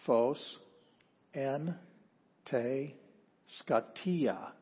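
Greek read out word by word; deliberate, isolated words of a Greek reading; reading aloud. Shown as a demonstration. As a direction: leave a long pause after every word.

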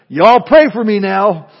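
loud, slow Southern cry; Southern accent. Retold or imitated. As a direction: imitate a boisterous Southern child calling out. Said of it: "crying out in this charming Southern accent".

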